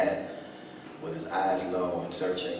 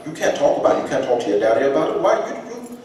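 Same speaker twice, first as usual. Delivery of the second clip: loud, high, fast demanding father; indignant grandfather; 7 semitones higher, 10 dB louder than usual. Retold or imitated. Grandfather scolding his son, loud and high. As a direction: push back hard at the son. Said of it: "father voiced in story".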